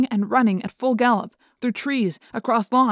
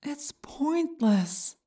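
whispery telepathic voice; 6 semitones higher, 7 dB quieter, much slower than usual. A narrator's reading. Yeerk voice in head.

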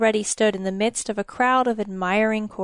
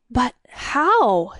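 stammered; 2 semitones higher, 4 dB louder than usual. A narrator's reading. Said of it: "he stammered".

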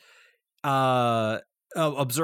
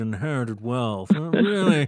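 William F. Buckley; 3 semitones lower than usual. Acted. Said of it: "a cross between your rich guy voice and that conservative from the seventies".

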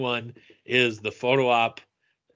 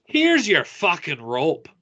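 slow, higher, lightly acted movie line; imitating movie character; 5 semitones higher, 4 dB louder, slower than usual. Actor handing back the rope to a fan, in character. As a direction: deliver it as a mock in-character line. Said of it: "in character".

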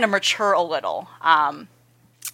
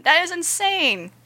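higher, emphatic repeat; excited; 9 semitones higher, slower than usual. Real, not acted. Fan gushing to co-host about animation, higher and excited. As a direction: lift it up, really amazed now.

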